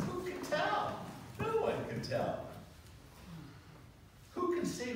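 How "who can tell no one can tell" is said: high, emphatic rhetorical questions; high emphatic challenge. Real, not acted.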